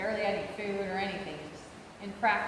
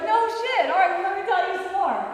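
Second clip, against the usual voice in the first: much higher, louder, fast sarcastic comeback; fired-up retort; 9 semitones higher, 8 dB louder than usual. Retold or imitated. Caller lecturing PayPal agent in a loud, high, fast, sarcastic rant. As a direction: hit back hard, relishing it. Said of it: "I was like".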